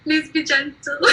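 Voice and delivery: high, mock-desperate begging; pleading. Contestant retelling how she begged the swab nurse, pleading. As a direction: beg playfully, on the edge of laughter. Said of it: "'cause I begged her".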